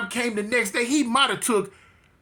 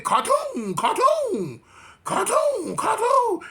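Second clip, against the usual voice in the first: high, sing-out mock holler; imitated hollering; 13 semitones higher, slower than usual. Inmate taunting his rival for half an hour, imitated high holler. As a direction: holler the nickname in a far higher pitch, mimicking him. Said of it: "sit there and holler that".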